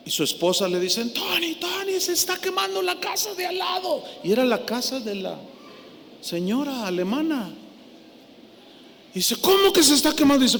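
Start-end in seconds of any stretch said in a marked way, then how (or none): 1.1-4.0 alarmed call. Retold or imitated. Wife and son call alarm. 9.4-10.4 startled question. Retold or imitated. Retold alarmed question.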